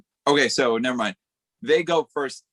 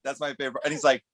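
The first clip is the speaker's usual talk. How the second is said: ordinary delivery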